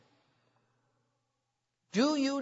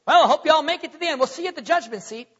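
rushed, higher mock farewell; breezy send-off; 6 semitones higher, 5 dB louder, much faster than usual. Preacher imagining God abandoning people, breezy and rushed. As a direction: toss it off fast, as if washing your hands.

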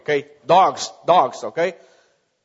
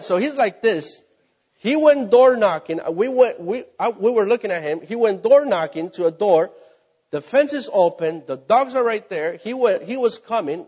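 usual speaking voice

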